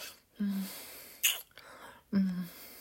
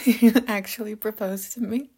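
overjoyed, higher and louder; delighted; 5 semitones higher, 8 dB louder than usual. Acted. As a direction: gush with joy. Overjoyed at proposal.

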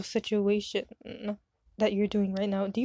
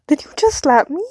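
high, loud shocked question; stunned by a sudden slap; just got slapped; 5 semitones higher, 10 dB louder than usual. Acted.

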